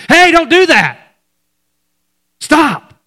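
high, loud urgent yell; shouted warning. Retold or imitated. Preacher voicing Paul yelling to the jailer, loud urgent shout. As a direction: yell it out to stop him. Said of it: "So Paul yells out".

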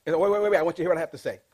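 a loud, mock pushy interrupter; pushy interruption. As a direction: barge in over them. Acting out a pushy talker.

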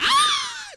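very high, loud excited squeal; pig-like squeal. Retold or imitated. Preacher imitating Janet seeing McDonald's, hammed-up squeal. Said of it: "Squeals like a pig".

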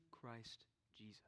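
soft whispered invitation; whispering. Real, not acted. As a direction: breathe it gently.

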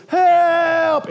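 a loud, high cry for help; shouted help. Acted. Man in gorilla suit yelling help at the lion. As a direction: yell it out in panic. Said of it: "And he says".

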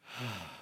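a slow audible sigh out, low and soft; long demo sigh. Shown as a demonstration. Pastor showing the church how to pause, with a sigh. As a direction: let the breath go in one long sigh. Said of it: "Watch me".

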